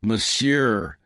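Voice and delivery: ordinary delivery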